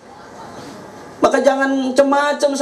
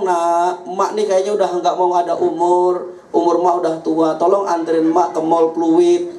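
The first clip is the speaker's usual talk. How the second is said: put-on low-pitched voice of an old mum pleading; aging mother; 8 semitones lower than usual. Old mother asks her child for a ride to the mall, low pleading. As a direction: lower the voice into a mother's humble request. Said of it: "Orang emaknya ngomong".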